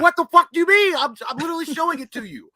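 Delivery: high, loud frustrated yell; exasperated yell. Retold or imitated. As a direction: shout it, losing patience.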